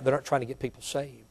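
ordinary talk

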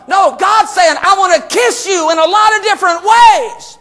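high-pitched emphatic proclamation; exuberant preaching shout. Acted. Preacher voicing God's affection to the congregation, exuberant shout. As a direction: declare it with rising fervour.

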